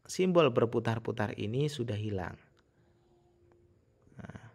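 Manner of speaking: ordinary talk, nothing marked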